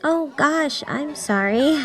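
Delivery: giggly apology. A narrator's reading. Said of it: "Haruna giggled".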